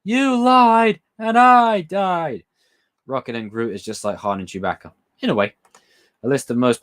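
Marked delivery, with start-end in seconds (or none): 0.0-2.4 much higher, very slow, fully hammed; high, drawn-out parody of the quote; over-the-top movie line. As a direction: wail every word out slowly and theatrically. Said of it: "remember the line".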